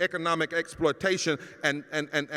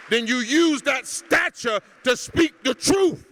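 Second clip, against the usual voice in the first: preaching at full force; 5 semitones higher, 7 dB louder than usual. Real, not acted. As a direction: build the sermon to a ringing climax. Preacher building to a climax.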